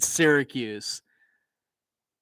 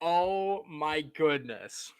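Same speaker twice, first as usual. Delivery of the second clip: slow disbelief; 5 semitones higher, much slower than usual. Real, not acted. Reacting to absurd kickoff.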